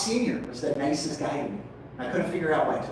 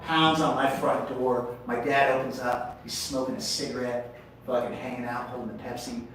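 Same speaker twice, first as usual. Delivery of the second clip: normal talking voice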